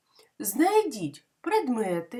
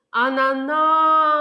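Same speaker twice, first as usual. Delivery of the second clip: "Ананас" with a long, loud final НАС; stress on last syllable; 5 semitones higher, 7 dB louder, slower than usual. Shown as a demonstration. Teacher shows which syllable is stressed, "Ананас" with a stretched, loud НАС. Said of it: "а який склад наголошений".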